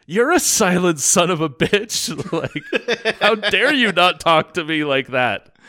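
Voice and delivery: loud, high, laughing imitation of a furious boss; hammed-up berating rant; mock berating yell. Boss yelling at a silent worker, mock angry shout.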